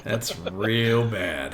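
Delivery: "real" stretched long and slow, pitched low; drawn-out REAL. Real, not acted. Game master reacts to a bad roll, slow stress on "real". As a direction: lean slowly on "real", dropping low and soft.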